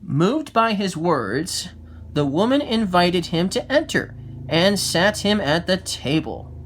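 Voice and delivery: plain reading voice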